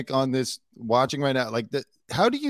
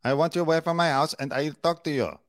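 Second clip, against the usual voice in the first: mock Spanish accent; 3 semitones higher than usual. Commentator mimicking the wife shooing people from her house, mock Spanish accent. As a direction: imitate a fake Spanish accent. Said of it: "she sounds like Ana de Armas as Marilyn Monroe".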